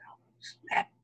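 unvoiced startled whisper; whispered oh snap. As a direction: breathe it out in shock. Shocked aside at phone call.

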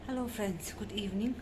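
soft whispered greeting, short of breath; breathless whisper. Real, not acted. Vlogger greeting viewers while out of breath, whispered. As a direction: greet them in a real whisper. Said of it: "सांस फूलती है ना बात करते हुए".

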